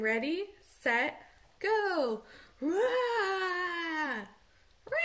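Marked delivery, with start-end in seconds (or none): 2.6-5.0 playful animal roar. Shown as a demonstration. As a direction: roar like a beast. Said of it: "Animal noise time".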